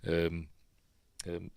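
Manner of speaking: ordinary talk, nothing marked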